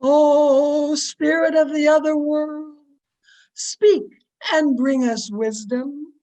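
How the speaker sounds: trance invocation. Acted. Medium begs the spirit to speak, theatrical intoning. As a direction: intone it grandly, summoning a spirit. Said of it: "I will go into a trance".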